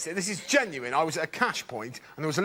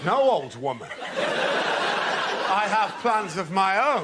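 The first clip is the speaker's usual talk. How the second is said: lofty; much slower than usual. Acted. Mock-lofty reply to old woman.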